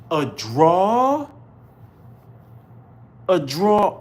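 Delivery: 3 ask it again like it can't be true; 1 incredulous. Real, not acted.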